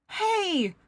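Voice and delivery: sharp high angry shout; angry snap. Acted. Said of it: "Kano snapped".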